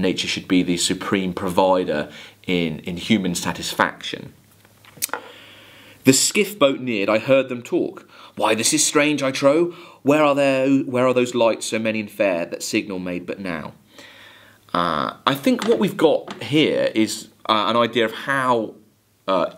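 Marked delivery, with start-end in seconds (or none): 6.0-13.7 a steady, measured recitation of a ballad; poem reading. Acted. Teacher reading Coleridge to students, measured poem recitation. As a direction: read the verse to the class with care.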